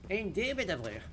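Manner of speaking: lightly voiced old-timey announcer; a hint of a pompous radio host. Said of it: "the dumb radio announcer voice".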